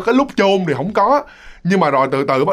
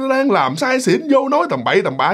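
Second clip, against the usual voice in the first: shooing him away; 8 semitones higher than usual. Retold or imitated. Villagers shooing off the drunk man, raised and annoyed. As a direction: push the drunk away with your voice. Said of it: "kéo ông ra".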